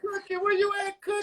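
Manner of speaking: high-pitched searching call; playful calling out. Real, not acted. Guest calling for host's mother, high playful call. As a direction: call for someone out of view.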